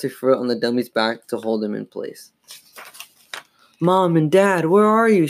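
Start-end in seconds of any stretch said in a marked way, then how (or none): 3.8-5.3 high, urgent calling out; crying aloud. A narrator's reading. Girl calling for her parents, crying aloud. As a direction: call anxiously for help. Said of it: "she cried aloud".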